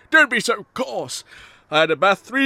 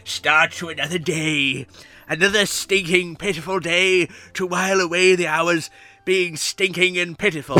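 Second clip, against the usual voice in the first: ordinary delivery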